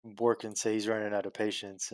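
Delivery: normal talking voice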